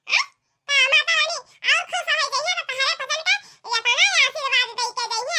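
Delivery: put-on high-pitched excited little kid; child voice. Small child telling granny about the song. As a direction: chatter like an eager child to granny.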